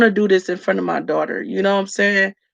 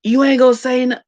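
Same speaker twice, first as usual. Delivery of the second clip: attitude; 5 semitones higher, 4 dB louder, faster than usual. Retold or imitated. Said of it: "like, just attitude".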